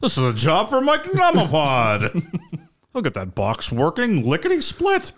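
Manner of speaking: high, hammed-up call to the hero; over-the-top superhero summons, pitched high; mock heroic announcement.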